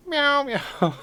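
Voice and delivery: high playful cat meows; mock meow. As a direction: meow like a kitten. Mimicking the cat for chat.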